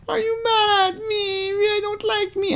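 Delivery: put-on very high, whiny girlish voice, on the edge of tears; mock whiny girl. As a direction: parody a hurt teenage girl asking if he is mad. Reading Kayla's hurt chat message.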